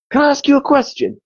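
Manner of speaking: a hint of a gruff Manc singer. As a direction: play the rock star leaning in. Retold [name] voice.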